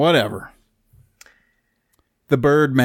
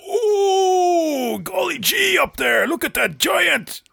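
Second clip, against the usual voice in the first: very high, drawn-out hammed-up Maine accent; much slower than usual. Shown as a demonstration. Co-host shows off his impression on request, exaggerated Maine accent. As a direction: holler it slowly in a cartoonish, high Maine accent. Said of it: "I can do the Maine accent".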